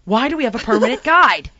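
ordinary delivery

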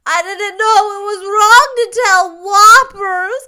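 put-on high, loud childish wail; sobbing small boy. A narrator's reading. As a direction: bawl it out like a crying child. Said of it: "he sobbed".

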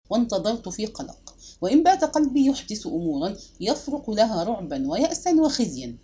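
ordinary delivery, nothing marked

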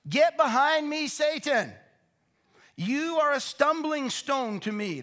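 loud, high, commanding rebuke; stern rebuke. Acted. Jesus rebuking Peter to his face, loud and stern. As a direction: drive Satan back with force.

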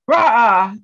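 short loud playful roar; mock roar. A fiery Mercury sign charging in, playful loud roar. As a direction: bark it like a fiery lion.

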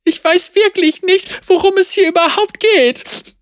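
high, tearful and pleading, voice cracking; on the edge of sobbing; voice breaking. Acted. Tamara pleads with the sheriff, voice breaking. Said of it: "Ihre Stimme brach und ihre Brust bebte unter heftigen Schluchzern".

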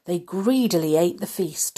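ordinary delivery, nothing marked

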